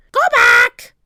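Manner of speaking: loud, screeching parrot voice; parrot squawk. A narrator's reading. Polly warning the pirates from above, squawking. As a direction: screech the words like a bird. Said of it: "squawked Polly".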